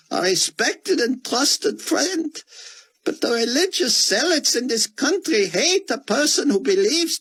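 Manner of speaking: put-on high, grieving old scholar; distraught old man.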